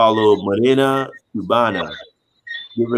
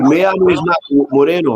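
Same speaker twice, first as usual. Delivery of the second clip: retold angry protest; 5 semitones higher, 4 dB louder than usual. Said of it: "I was like".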